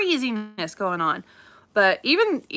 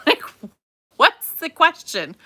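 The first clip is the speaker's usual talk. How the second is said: incredulous; 8 semitones higher, 7 dB louder than usual. Real, not acted. Mock disbelief after laughing.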